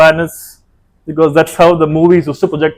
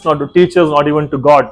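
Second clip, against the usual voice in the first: usual speaking voice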